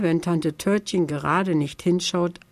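plain reading voice

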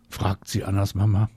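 ordinary delivery

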